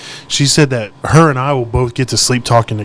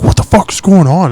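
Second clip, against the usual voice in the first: louder, higher alarmed outburst; freaking out; 6 semitones higher, 6 dB louder than usual. Retold or imitated. Girlfriend's dad finding a sleepwalker downstairs, freaking out. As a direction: imitate a panicked, baffled demand. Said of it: "Gabby's dad's freaking out".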